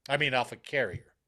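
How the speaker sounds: ordinary talk, nothing marked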